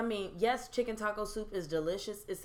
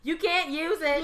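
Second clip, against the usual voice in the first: exasperated; 7 semitones higher, 7 dB louder, slower than usual. Real, not acted. Exasperated retort about the pool.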